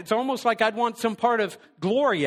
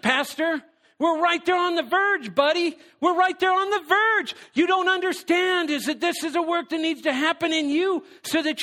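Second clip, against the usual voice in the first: a lightly voiced God, higher and patient; God answering; 10 semitones higher than usual. God tells the pastor he is on the verge, lightly voiced and higher. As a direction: coax him along like a knowing friend.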